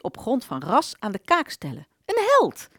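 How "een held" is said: sudden high exclaim, half ironic; mock praise. Servant hails the father as a hero, excited. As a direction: throw it up high like a cheer.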